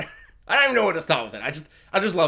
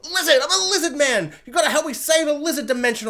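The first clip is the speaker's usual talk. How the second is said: higher, louder mock reveal; hammed-up lizard man; 9 semitones higher, 3 dB louder than usual. Dad pleading with his kids, hammed-up higher and louder. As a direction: belt out the lizard confession. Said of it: "And he's like".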